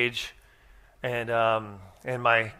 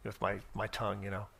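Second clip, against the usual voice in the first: smug inner voice; 4 semitones lower, 8 dB quieter than usual. Retold or imitated. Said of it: "And I thought".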